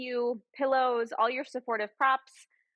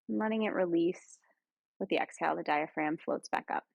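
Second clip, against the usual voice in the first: guided meditation; 3 semitones lower than usual. Real, not acted. Yoga guide leading friends through breath and body scan, guided meditation. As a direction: ease them into stillness, lower and unhurried.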